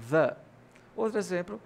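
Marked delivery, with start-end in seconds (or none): 0.1-0.4 THE with a short uh vowel; THE as "thuh". Shown as a demonstration. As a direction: say THE alone as "thuh". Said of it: "Então, a pronúncia é o".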